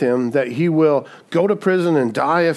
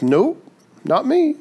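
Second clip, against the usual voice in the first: a hint of breezy denial; 9 semitones higher, slower than usual. Peter retold denying again.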